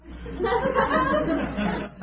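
high burst of laughter; embarrassed high laugh. Real, not acted.

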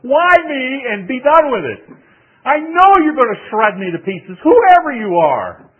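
high, loud, desperate plea to be left alone; anguished outburst. Retold or imitated. Young preacher wailing at the man catching up behind him, high and loud. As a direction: cry out like a humiliated young preacher. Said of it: "turn around and say".

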